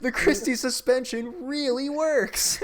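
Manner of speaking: laughing high and loud, slowly. Real, not acted. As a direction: crack up while talking. Joking about bumpy tank ride.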